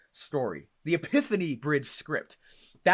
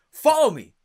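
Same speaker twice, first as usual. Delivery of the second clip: louder, higher announcer opening; podcast intro; 9 semitones higher, 10 dB louder than usual. Retold or imitated. Host reciting his own podcast opening, announcer style. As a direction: launch it like a show intro. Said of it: "That's literally my podcast intro".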